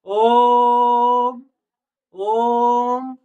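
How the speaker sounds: drawn-out ओम demonstration; held om. Shown as a demonstration. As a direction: stretch the ओ of ओम. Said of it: "ज्यादा समय लगता है".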